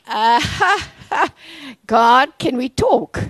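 a half-joking loud appeal to God; mock protest to God. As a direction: play her bargaining with God, hammed-up. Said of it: "she's like".

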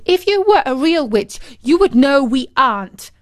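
high, forceful telling-off; scolding. A narrator's reading. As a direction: scold like an indignant girl. Girl scolds the fake witch.

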